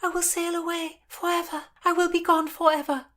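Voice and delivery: put-on high-pitched girl pleading her exile; young noblewoman announcing her flight; young woman's voice. Narrator voices young Constance.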